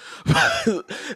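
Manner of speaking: high-pitched burst of laughter. Real, not acted. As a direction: squeal of a laugh.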